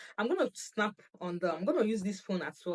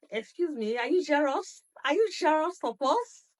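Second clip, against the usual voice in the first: teasing; 8 semitones higher, 5 dB louder than usual. Retold or imitated. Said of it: "I told my husband".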